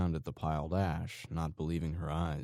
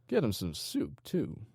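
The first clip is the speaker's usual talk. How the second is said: ordering; 7 semitones higher than usual. Acted. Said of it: "he ordered".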